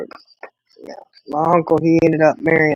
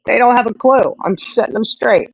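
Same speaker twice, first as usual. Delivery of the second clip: higher, sassy know-it-all; bossy attitude; 7 semitones higher, 3 dB louder than usual. Retold or imitated. Daughter setting her brothers straight, sassy higher voice. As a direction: play the bossy little girl. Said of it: "that attitude of".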